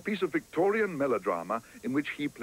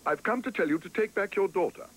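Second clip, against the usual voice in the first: higher, earnest exclamation; exclaiming; 8 semitones higher than usual. Acted. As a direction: blurt it out with earnest conviction. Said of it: "exclaimed Paddington".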